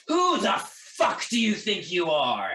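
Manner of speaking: loud, slow snarled threat; feral snarling. Acted. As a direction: snarl it at them. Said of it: "he just snarls at you and says".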